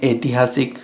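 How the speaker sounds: "ऐतिहासिक" starting with plain e; common mispronunciation. Shown as a demonstration. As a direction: say "ऐतिहासिक" as etihaasik. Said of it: "हम बोलते हैं".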